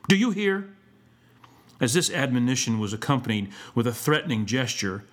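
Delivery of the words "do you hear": high, sharp schoolmaster warning; stern, rising demand; savage threat. A narrator's reading. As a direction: press the threat on a pupil. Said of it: "uttered with a savage aspect".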